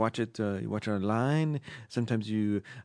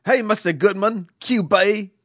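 reading the letter in a louder put-on Australian accent; 3 semitones higher, 4 dB louder than usual. Reading an Australian listener's letter, put-on Australian accent. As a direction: play the indignant Aussie listener, a bit loud. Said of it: "This is an Australian accent".

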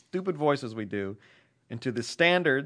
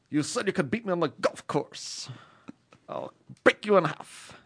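Tim Goodman impression; 2 semitones higher, 5 dB quieter than usual. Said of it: "Tim Goodman".

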